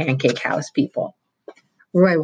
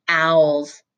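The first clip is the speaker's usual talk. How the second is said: triumphant; 2 semitones higher, 4 dB louder than usual. A narrator's reading. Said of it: "Roy proclaimed triumphantly".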